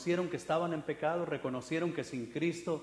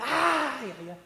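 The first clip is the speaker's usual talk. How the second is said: much higher, breathy swooning ay; mock swooning crowd; 8 semitones higher, 4 dB louder, slower than usual. The crowd reacting to the showy preacher, mock swooning. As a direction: sigh like a moved congregation. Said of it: "Y la gente".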